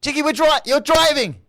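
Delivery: loud, very high acted panic; scared friend. Passenger warning the daydreaming driver, high scared yelling. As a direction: shriek it like a terrified passenger. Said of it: "your friend is really scared".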